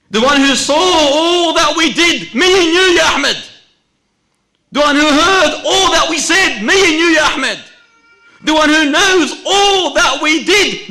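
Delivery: very high, full-force shouting; desperate outcry. Acted. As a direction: cry it out, pleading at the top of your voice. Dying brother's desperate outcry.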